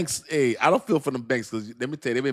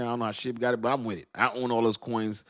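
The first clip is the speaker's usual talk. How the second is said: ordinary talk, nothing marked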